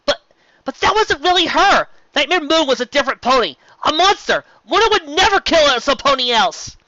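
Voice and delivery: high, loud defensive outburst; defensive shout. A narrator's reading. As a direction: shout it, rushing to defend her. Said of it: "it was defensive, almost coming off as a shout".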